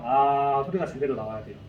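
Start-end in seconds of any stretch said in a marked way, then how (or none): 0.0-0.6 sustained loud ahh sound; saying ahh. Shown as a demonstration. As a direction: hold a steady loud 아. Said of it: "아, 소리 내볼게요".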